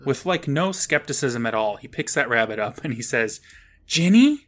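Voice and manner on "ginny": high, loud questioning cry; rising, incredulous shout of a name; mock astonished calling. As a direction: call out in hammed-up disbelief. Boy asks rabbit if it's sister.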